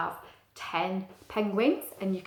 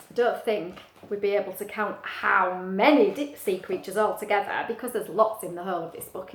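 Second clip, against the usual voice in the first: plain reading voice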